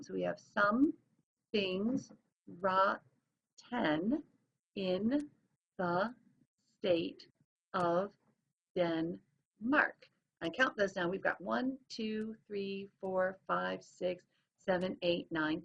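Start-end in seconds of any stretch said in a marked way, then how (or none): 0.4-10.1 "Some, things, rotten, in, the, state, of, Denmark" with a pause after each; syllable by syllable. Shown as a demonstration. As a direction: count out "Some things rotten" one isolated beat at a time. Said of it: "looking at where did the breaks come".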